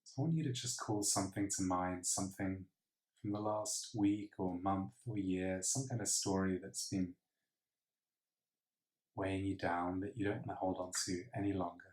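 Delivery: soft breathing-exercise voice, long pauses between counts; hushed, calm meditation guide; guided meditation. Acted. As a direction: count breaths quietly and evenly.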